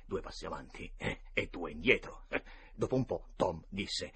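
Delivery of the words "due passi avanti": ordinary delivery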